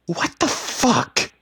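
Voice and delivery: very high, loud, drawn-out scream; outraged shriek; screamed disbelief. A narrator's reading. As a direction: scream it across the water. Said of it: "Tabitha screamed loud enough".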